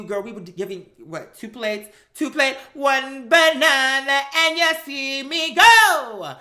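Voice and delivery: ordinary talk, nothing marked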